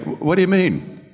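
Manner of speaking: high, rattled questioning; shaking nervous. Retold or imitated. Gambit actor questioning Norm's remark, mock-nervous. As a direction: imitate a nervous, thrown actor. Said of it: "now he's shaking, like".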